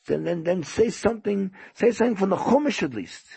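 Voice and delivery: high, stammered insistence; pleading host. Retold or imitated. Host begs guest for at least Chumash, pleading. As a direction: plead for the bare minimum, pitched high. Host makes a last plea.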